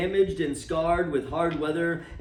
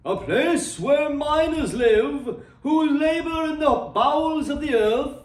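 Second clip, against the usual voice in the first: the spirit's reply; 9 semitones higher, 5 dB louder than usual. A narrator's reading. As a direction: answer like a lofty ghost, drawing it out. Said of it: "returned the spirit".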